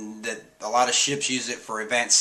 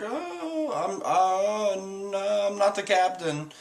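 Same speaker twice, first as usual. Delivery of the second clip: high, stammering mock fright; mock freak-out; 8 semitones higher, slower than usual. Caribou freaking out at Zoro, high frightened denial. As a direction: stammer it high, backing out of blame. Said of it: "freaks out".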